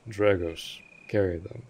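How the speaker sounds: normal talking voice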